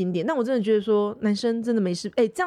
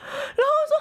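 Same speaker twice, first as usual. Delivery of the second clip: high squealing laughter; burst of laughter. Real, not acted. Host cracks up retelling the story, laughing high. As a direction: crack up helplessly.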